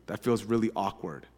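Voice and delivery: quiet inner doubt; uneasy inner thought. Retold or imitated. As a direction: think it to yourself, unsure. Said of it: "I remember thinking".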